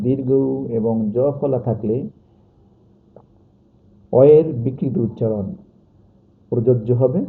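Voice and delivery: normal talking voice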